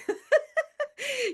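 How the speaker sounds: high burst of laughter; high giggle; real laugh. Real, not acted. Laughing after the story.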